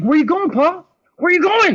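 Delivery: high, loud call after the car; retold shout. Chasing the boyfriend's car on foot, loud calling out. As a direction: yell it after someone driving off.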